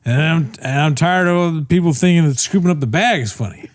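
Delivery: high, slurred Southern tirade; hammed-up drunken Southern indignation; McCarthy, drunk Southern accent. Comic as McCarthy fed up with jokes, drunk Southern accent. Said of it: "my drunk is Southern".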